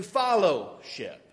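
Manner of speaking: FELLOWSHIP with an "ah" in the first syllable, "FOLLOW-ship"; said as followship. Shown as a demonstration. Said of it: "I'm saying fellowship".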